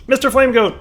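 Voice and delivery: high, loud happy call-out; excited greeting. Real, not acted. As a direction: greet him like a cheer. Greeting a friend joining.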